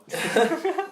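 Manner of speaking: high-pitched burst of laughter; real laughter, high. Real, not acted. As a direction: crack up at the joke. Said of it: "Pie, pie, pie chucker".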